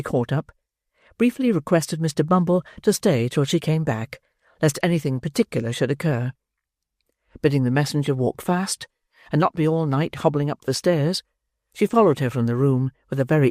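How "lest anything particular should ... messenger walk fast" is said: plain reading voice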